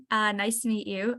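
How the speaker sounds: ordinary talk, nothing marked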